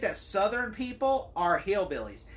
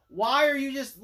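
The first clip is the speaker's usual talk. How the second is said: exasperated question; 7 semitones higher, 6 dB louder than usual. Retold or imitated. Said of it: "I'm just like".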